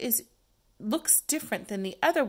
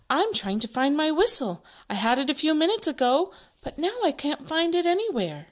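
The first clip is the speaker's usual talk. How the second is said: higher, louder, hurried; lively read-aloud character line; acted play character; 7 semitones higher, 4 dB louder, faster than usual. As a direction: rush through it, pitched up and worked up. Teacher acting out play.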